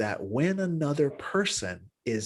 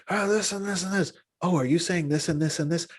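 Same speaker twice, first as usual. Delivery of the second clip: higher, bright curious listener voice, leaning in; eager empathic listener; 7 semitones higher than usual. Shown as a demonstration. Demonstrating to students an eager, higher listening voice. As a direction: play the friend who really wants to understand.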